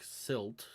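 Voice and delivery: Silt drawn out slowly; stress on Silt. Acted. Answering back about the nickname, Silt slowly emphasized. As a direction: set Silt apart, slow and soft. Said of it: "he emphasizes your name".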